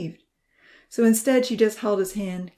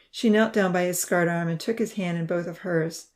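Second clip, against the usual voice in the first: ordinary delivery, nothing marked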